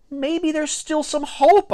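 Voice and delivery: high, wishful inner voice; hopeful thought. A narrator's reading. Boy on the mound hoping for strikes, high wishful thought. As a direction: think it hopefully, pitched well up. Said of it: "I thought".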